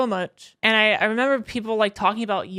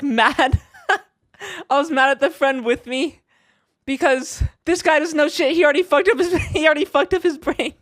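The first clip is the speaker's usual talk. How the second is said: higher, laughing through the words; giggling at own memory of anger; laughing while telling; 6 semitones higher, 4 dB louder than usual. Real, not acted. Laughing about being mad.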